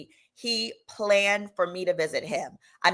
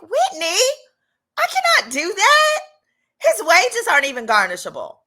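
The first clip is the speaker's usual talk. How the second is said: mock outrage; 10 semitones higher, 6 dB louder than usual. Refusing her friend Whitney's advice, high outraged squeal. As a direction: squeal the protest in disbelief.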